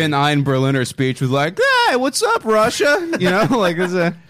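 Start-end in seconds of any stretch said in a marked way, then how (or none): 1.9-3.1 very high, chirpy mock speechmaker; goofy mock greeting. Guest parodies a botched Berlin speech opening, goofy high greeting. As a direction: hail the crowd in a silly high voice.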